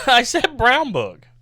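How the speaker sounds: laughing loud repeat. Real, not acted. Repeating with emphasis.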